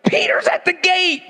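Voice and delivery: high excited announcement; a girl bursting with the news at the door. Retold or imitated. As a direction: blurt it out thrilled and breathless. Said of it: "She's like".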